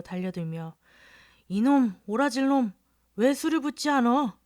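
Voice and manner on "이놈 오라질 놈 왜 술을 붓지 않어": put-on loud drunken scolding; scolding yell. A narrator's reading. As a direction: bark at the boy for not pouring. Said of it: "라고 야단을 쳤다".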